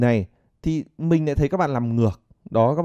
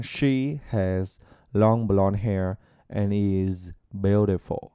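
slow gentle demo; 4 semitones lower, much slower than usual. Shown as a demonstration. Teacher models the sentence slowly and gently for learners. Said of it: "Cứ nói nhẹ nhàng".

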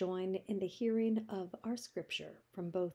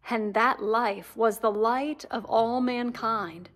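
proclaiming, louder Bible reading; loud scripture reading; 10 dB louder than usual. Acted. Reader proclaiming John's prologue to the church, loud and solemn. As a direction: declare the Gospel to the whole room.

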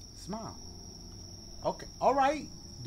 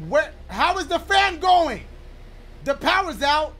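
high, raised, baffled exclamation; much louder and pitched up; loud alarm; 7 semitones higher, 9 dB louder than usual. Real, not acted. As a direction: exclaim over a sudden power cut. Reacting loudly to game power cut.

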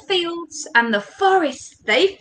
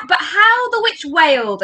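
ordinary delivery, nothing marked

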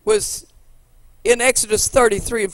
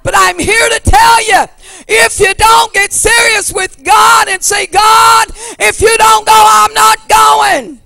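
loud, high pleading preacher's cry to the Lord; full-force preaching; 10 semitones higher, 9 dB louder than usual. Acted. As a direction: shout the prayer out to God.

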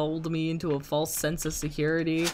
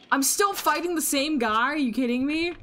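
exasperated; 9 semitones higher, 3 dB louder than usual. Real, not acted. Same opponent again.

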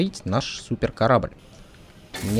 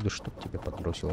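muttered frustrated curse, very soft; cursing under breath; 6 semitones lower, 13 dB quieter than usual. Real, not acted. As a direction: grumble the curse to yourself. Pipes keep not fitting. (ругательство себе под нос)

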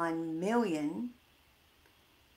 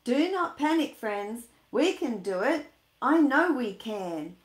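loud, higher reassuring pep talk; steady the elves with confidence; louder jolly leader rallying his crew; rallying Santa; 4 semitones higher, 7 dB louder than usual. Acted. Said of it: "Santa speaks up".

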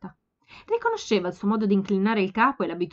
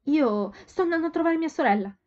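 Sophie; 7 semitones higher, 4 dB louder than usual. A narrator's reading. Sophie voiced in story.

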